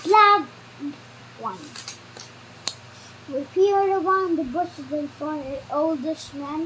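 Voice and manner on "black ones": loud high slow yell; shouting old man. Acted. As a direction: bark orders at the top of the tree.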